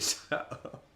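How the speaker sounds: breathless whisper; embarrassed whisper to chat; whispered shut up. Real, not acted. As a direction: hiss it under your breath.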